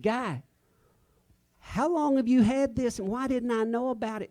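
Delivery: half-mock amazement, pitched well up; retelling his own stunned question to Guy; amazed. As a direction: replay the moment of disbelief at finding it. Bursting in with the found guitar.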